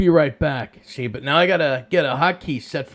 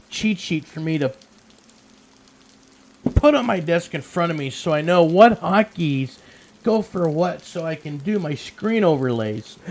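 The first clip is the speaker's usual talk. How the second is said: ordinary talk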